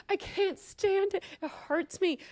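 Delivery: high, drawn-out anguished complaint; pained exasperation. Real, not acted. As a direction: wail it in comic despair. Venting about favourites playing badly.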